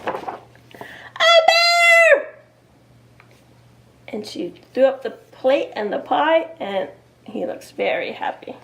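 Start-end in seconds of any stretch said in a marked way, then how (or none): 0.0-2.3 high, loud long gasp of shock; shocked shriek. Acted. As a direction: scream as the bear looms. Mom sees the bear.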